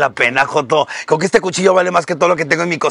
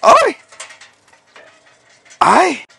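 sharp high ay of pain; pained yelp; 10 semitones higher than usual. Real, not acted. Host burned by the grill, a high pained yelp. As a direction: yelp as the heat bites.

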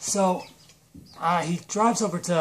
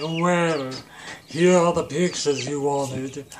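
drawn-out put-on villain voice; stretched cartoon villain, ending in a loud groan; Space Voldemort; 4 dB louder, slower than usual. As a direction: present it slowly as a creepy clerk. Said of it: "what Space Voldemort was screaming about".

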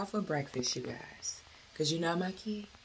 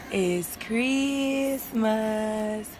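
drawn out; 3 semitones higher, much slower than usual. Real, not acted. Waking kids, drawn out.

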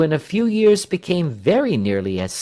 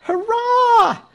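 very high, loud, drawn-out cheer; cheering shout; 7 dB louder than usual. A narrator's reading. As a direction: cheer for the whole crowd. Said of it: "shouted everybody".

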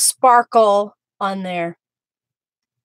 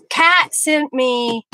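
ordinary delivery

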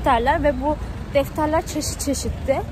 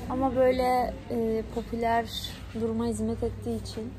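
quiet at night; 8 dB quieter than usual. Real, not acted. Talking softly inside the shop.

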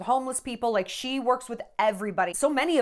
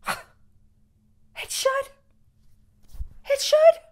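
high, tearful and breaking; crying; 4 dB louder, much slower than usual. Real, not acted. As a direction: voice breaking into tears. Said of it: "I know why I'm gonna cry".

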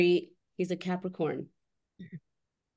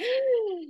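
sharp gasp. Retold or imitated. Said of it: "I'm like".

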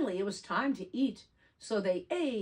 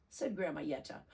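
plain reading voice

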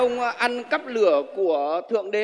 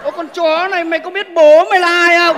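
higher, louder angry yell; angry shout; 7 semitones higher, 9 dB louder than usual. Acted. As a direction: fire the insult right back. Thief yells back at owner.

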